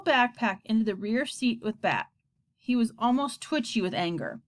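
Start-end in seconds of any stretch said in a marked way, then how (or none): none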